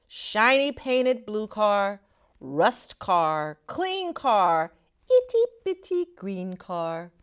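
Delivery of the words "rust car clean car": plain reading voice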